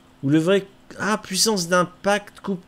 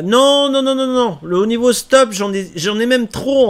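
loud protest; 7 semitones higher, 7 dB louder than usual. Real, not acted. Refusing loudly.

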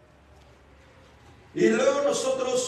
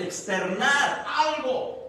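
loud, drawn-out and raised in pitch; emphatic outburst; 6 semitones higher, 5 dB louder, much slower than usual. Shown as a demonstration. Preacher explains the Greek word to the congregation, emphatic outburst. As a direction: push the words out slowly and forcefully. Acting out outward expression of feeling.

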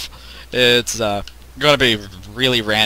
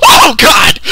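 high loud frightened cry; real panicked yelp; terrified cry; 10 dB louder than usual. Real, not acted. Jump scare in game.

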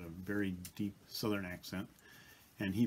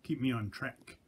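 normal talking voice

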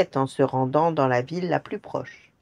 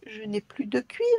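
soft, high complaining whine; whining; 5 semitones higher, 6 dB quieter than usual. Acted. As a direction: moan it in despair. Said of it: "gémit le lutin".

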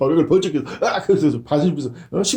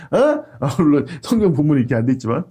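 ordinary talk

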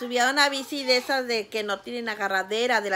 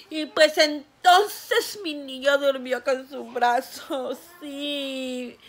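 laughing through tears; much slower than usual. Real, not acted. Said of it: "laugh cry".

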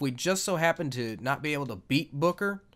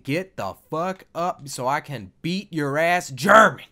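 slow, loud-ending challenge; growing louder toward the final word; Brock Lesnar impression; 8 dB louder than usual. Gamer voicing Edge ordering Booker up, slow tough-guy impression. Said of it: "Brock Lesnar impression".

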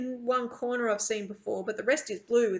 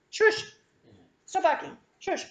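high, louder real hushing command; shushing a dog; 9 semitones higher, 4 dB louder than usual. Real, not acted. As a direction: tell the dog to stop barking. Scolding a barking dog mid-reading.

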